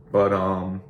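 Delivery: ordinary delivery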